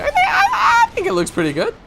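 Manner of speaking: laughing. Real, not acted. Laughs at own stumbling.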